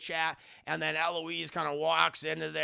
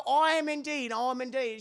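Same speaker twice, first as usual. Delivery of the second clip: high, prim little reply; lightly voiced polite girl; a hint of a prim girl; Eloise's voice; 8 semitones higher than usual. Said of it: "She said".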